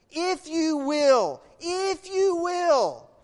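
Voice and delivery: loud, high, slow preaching cry; impassioned divine plea. Acted. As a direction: stretch each word out with fervour. Preacher voicing the Lord's emotion.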